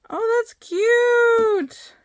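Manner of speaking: high, slow, drawn-out coo of delight. Real, not acted. Crafter cooing over a Hocus Pocus bracelet, high and slow. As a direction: melt over something adorable.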